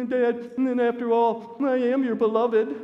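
high, loud mock bawling; hammed-up tearful whine; imitated sniffling child. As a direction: plead like a crying kid, laid on thick. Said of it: "he's sniffing it all".

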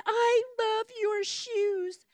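put-on slow, wistful little girl's voice; a sick child gazing at pretty shoes, slowly. Sick little girl admiring shoes.

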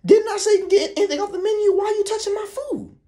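high, squeaky angry uncle; mock angry uncle.

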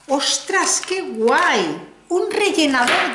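surprised exclamation at a lip plumper; pleasantly surprised. Real, not acted. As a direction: react as if you just found a treasure. Surprised by a new product.

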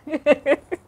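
a short high laugh; amused laugh. Real, not acted. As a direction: laugh at your own joke. Laugh after acted plea.

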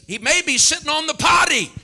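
high, loud, laughing jeer; mocking taunt. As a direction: deliver the cheeky punchline as a shouted taunt. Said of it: "Elijah starts mocking them".

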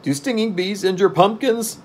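high-pitched anxious Jack; timid, high-voiced question; fearful. A narrator's reading. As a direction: ask it in a high, worried character voice. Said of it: "glancing around fearfully".

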